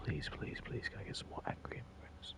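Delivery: soft whispered mock plea; sarcastic whisper. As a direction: hush it like a secret wish. Sarcastic pleading aside.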